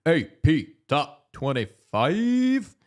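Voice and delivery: slow, loud, high announcer call. Pretend. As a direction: announce it like a big show. Announcing segment title.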